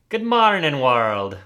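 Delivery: loud, high mock Irish greeting; a short bad Irish accent showing off practice. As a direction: belt it out in a hammed-up Irish lilt. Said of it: "with an Irish accent".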